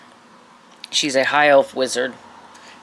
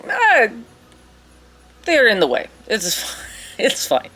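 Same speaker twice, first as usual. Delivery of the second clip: offhand, hammed-up Russian accent; dismissive wizard with a cartoonish Russian accent; bad Russian accent; 8 semitones higher than usual. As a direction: shrug it off in a fake Russian accent. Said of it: "she has a very bad Russian accent".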